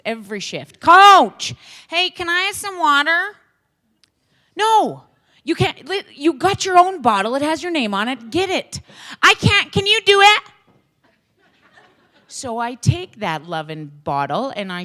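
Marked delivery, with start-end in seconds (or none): 0.8-3.4 put-on high, loud whiny kid calling coach again and again. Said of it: "he sounds like Caillou". 4.5-8.8 mock stern coach. Coach voicing himself refusing kid. 9.2-10.4 put-on high, loud whiny kid calling coach again and again. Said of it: "he sounds like Caillou".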